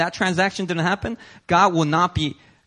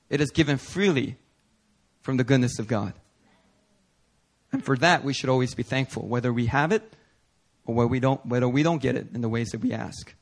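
normal talking voice

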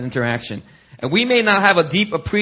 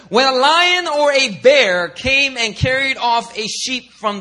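high, slow, bold retelling of the fight; a hammed-up brave David, higher and slow; David's boast; 7 semitones higher, 3 dB louder, slower than usual. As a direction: lift it like a proud shepherd boy. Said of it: "he goes on and says".

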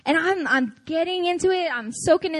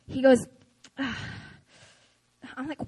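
normal talking voice

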